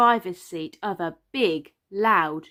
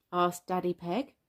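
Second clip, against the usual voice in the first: plain reading voice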